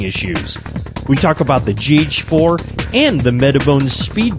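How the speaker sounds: radio show intro read; upbeat broadcast opener; announcer voice. Acted. Podcast opener over music.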